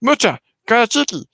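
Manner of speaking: high alien gibberish; squeaky little alien chatter; Six Two Six. Acted. Alien babbles at a frog in the forest, high-pitched. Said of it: "He says".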